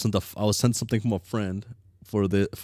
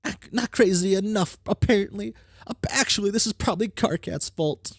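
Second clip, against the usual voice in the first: put-on bright, higher girl character read; troll girl character voice; 10 semitones higher than usual. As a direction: voice the troll with cocky energy. Reads game character lines.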